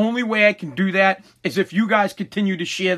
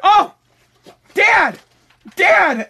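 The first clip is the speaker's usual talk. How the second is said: much higher, louder sing-song calling of Dad; imitating daughter calling; 11 semitones higher, 6 dB louder, much slower than usual. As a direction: pop your head in and call Dad. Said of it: "She went".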